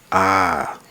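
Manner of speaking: ordinary delivery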